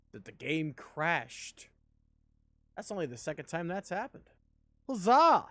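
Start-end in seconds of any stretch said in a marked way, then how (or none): 4.9-5.5 loud, high mock triumph; ironic cheer. Real, not acted. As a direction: cheer a disaster as if it were a win. Cheering the game crash.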